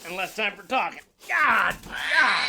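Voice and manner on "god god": sharp, high, loud cry of God; yelling out in sudden pain; startled shout. Real, not acted. Reacting to a sudden shock, a loud cry.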